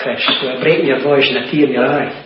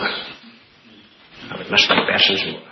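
very slow and low, almost whispered; hushed, heartfelt; 9 semitones lower, 5 dB quieter, much slower than usual. Real, not acted. Preacher confiding his love for men in Scotland, hushed. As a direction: sink into a soft, drawn-out near-whisper.

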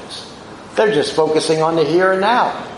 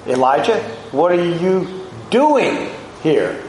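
DOING pitched up and set apart by a small pause before it; stress on DOING. Shown as a demonstration. God questions Elijah's self-pity, stress on DOING. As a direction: hit DOING in "what are you doing here". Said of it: "could have been emphasizing this way".

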